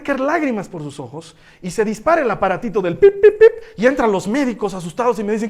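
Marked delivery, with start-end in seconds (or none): none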